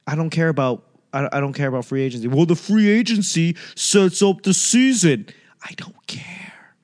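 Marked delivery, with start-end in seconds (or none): 2.3-5.3 mock critic. Voicing an imagined critic. 5.6-6.8 slow whispered I don't care. Real, not acted. Brushing off the free agency crowd in a slow whisper. Answering the critic.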